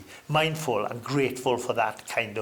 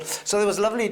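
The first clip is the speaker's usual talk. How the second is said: ordinary talk, nothing marked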